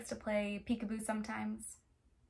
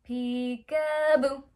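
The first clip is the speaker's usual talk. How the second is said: drawn-out, louder peekaboo call; playful peekaboo; 8 dB louder, slower than usual. Pretend. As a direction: sing it out slowly for the kids. Peekaboo game with children.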